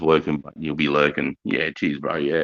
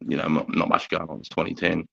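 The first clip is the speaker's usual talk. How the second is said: ordinary talk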